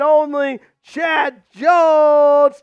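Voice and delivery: drawn-out shout. Real, not acted. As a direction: call the name like a ring announcer. Announcing the donor.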